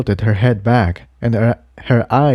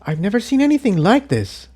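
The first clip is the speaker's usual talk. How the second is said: high, quick, awed mare voice; a young mare, amazed at the strange trees; Incendia's voice; 12 semitones higher, faster than usual. A narrator's reading.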